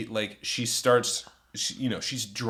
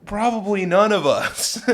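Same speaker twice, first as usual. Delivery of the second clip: awkward refusal; 9 semitones higher, 6 dB louder than usual. Retold or imitated. Awkward refusal of drunk woman.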